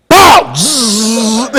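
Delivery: loud pop then buzzing zap; flash sound effect. Retold or imitated. Describing old Polaroid flash, loud sound effect. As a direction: mimic the camera flash blowing.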